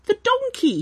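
high whimpering "The donkey"; a scared goblin's whimper; high frightened squeak. Acted. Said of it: "he whimpered".

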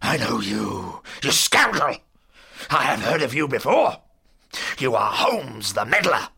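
loud, contemptuous bully; thunderous old villain denouncing a foe; angry accusation. Acted. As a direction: hurl the insults with force. Villain insults Holmes.

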